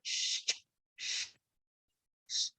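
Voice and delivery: clipped sh, cut off quickly; short sh bursts. Shown as a demonstration. Teacher showing students to cut sh short. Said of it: "say sh and then cut it short".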